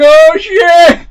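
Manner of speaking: high, loud oh shit; mock alarm. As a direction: exclaim in startled panic. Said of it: "and you're like".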